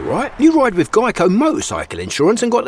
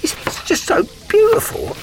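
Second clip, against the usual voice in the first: teary; 10 semitones higher than usual. Acted. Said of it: "Not this onion I'm chopping?".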